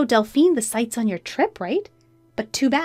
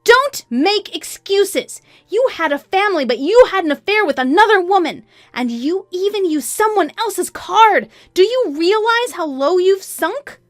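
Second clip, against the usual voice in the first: high, loud, furious tirade; pent-up anger; 10 semitones higher, 7 dB louder than usual. A narrator's reading. Wife raging at cheating husband, furious. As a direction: let all the held-in anger out. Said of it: "let out all the anger".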